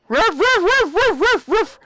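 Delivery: high, evenly repeated ruffs; playful dog barks. Pretend. Player barks along with the dog, playful dog barks. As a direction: yap like an excited dog.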